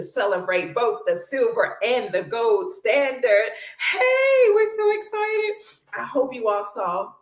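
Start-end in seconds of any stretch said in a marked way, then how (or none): 3.9-5.5 high, loud excited hey. Real, not acted. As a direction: burst with excitement.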